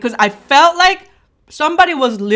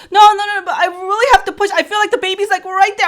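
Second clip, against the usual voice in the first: desperate insisting; 8 semitones higher than usual. Retold or imitated. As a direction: insist in a panic. Insisting she must push.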